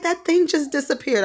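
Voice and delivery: high-pitched retold amazement; high, stunned exclamation; astonished. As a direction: imitate him exclaiming in disbelief. Acting out his astonished report.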